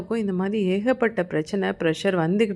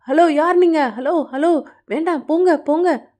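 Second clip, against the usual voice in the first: high, loud alarmed challenge; panicked shouting; 9 semitones higher, 5 dB louder than usual. A narrator's reading. Family confronts masked intruders, panicked shouting. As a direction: shout them away in rising panic.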